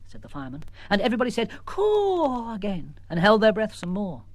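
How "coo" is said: high amazed coo; crowd's coo. Acted. Crowd again.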